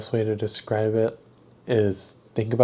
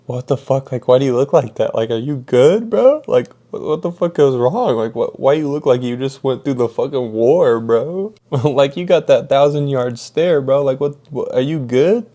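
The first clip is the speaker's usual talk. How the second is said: mock-bewildered, voice climbing on the questions; alarmed disbelief; 4 semitones higher, 5 dB louder than usual. Worker asks his shaken boss what went wrong, alarmed disbelief. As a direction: imitate baffled concern, loud and rising at the ends. Alarmed at boss's traumatized look.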